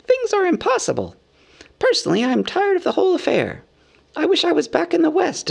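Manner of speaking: high-pitched hobbit making his case; Bilbo, business manner. A narrator's reading. Hobbit states his claim to a share, business manner. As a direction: talk business in a high, fussy little voice. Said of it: "Bilbo was saying in his best business manner".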